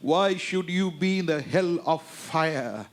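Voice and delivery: drawn-out English sermon lines; slow preaching. Acted. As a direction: stretch each word out slowly.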